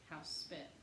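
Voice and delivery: plain reading voice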